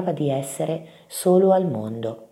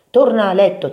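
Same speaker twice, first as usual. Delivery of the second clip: louder, raised parental command; firm order; 6 semitones higher, 7 dB louder than usual. A narrator's reading. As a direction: send the child back to bed. Big mouse orders him to bed. (ordine deciso)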